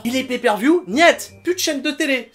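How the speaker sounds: plus aigu et fort, catégorique; coup de gueule. Real, not acted. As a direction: marteler un refus sans appel. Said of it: "peut-être un peu coup de gueule". (coup de gueule catégorique)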